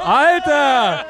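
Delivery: loud, high, laughing exclamation; real whooping outburst with a laugh; amazed shout. Real, not acted. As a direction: burst out in delighted amazement. Amazed cheer at recognised bassist.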